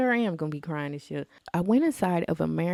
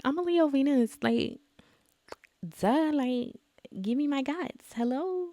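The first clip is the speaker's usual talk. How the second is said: sassy retort; 9 semitones higher than usual. Retold or imitated. Sassy retold quote about herself.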